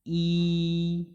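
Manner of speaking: "ई" drawn out for two beats; long vowel. Shown as a demonstration. Teacher demonstrating a two-beat long vowel. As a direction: hold "ई" long and steady. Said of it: "इसमें दो मात्राओं का समय लगे".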